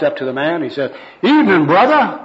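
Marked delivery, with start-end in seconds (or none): 1.2-2.2 loud, high-pitched greeting; hammed-up soul-winner's hello; third gospel worker. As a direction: hail him as an overeager evangelist. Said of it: "personal worker number three".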